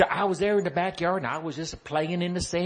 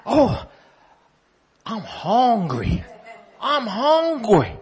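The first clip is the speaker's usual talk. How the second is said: put-on hungry child; 3 semitones higher, 7 dB louder, much slower than usual. Hungry child whining aloud.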